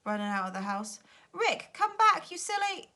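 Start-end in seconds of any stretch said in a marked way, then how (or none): 1.3-2.9 high, loud, slow call after Rick. A narrator's reading. Joe shouting up the ladder after Rick, alarmed. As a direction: shout him back.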